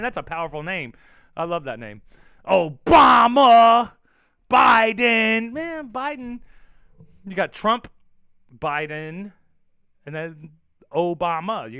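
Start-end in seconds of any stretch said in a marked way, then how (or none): 2.4-6.4 very loud, high, drawn-out bellow; hammed-up yelling of names; mock blaming shout. Shown as a demonstration. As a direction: holler each name, stretching it right out. Compares names as blame shouts.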